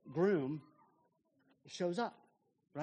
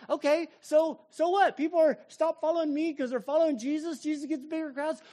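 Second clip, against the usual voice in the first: higher and louder, tossing it off gladly; so what, great, higher and louder; carefree and elated; 8 semitones higher, 9 dB louder than usual. Acted. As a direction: shrug it off with delight. Voicing John's carefree reply.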